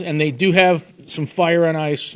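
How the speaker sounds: ordinary talk, nothing marked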